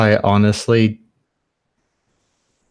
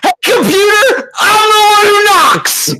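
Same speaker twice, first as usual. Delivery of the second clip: ghostly wail; 4 dB louder than usual. Pretend. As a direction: shriek it like a ghost. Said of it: "I really hope you scream like that".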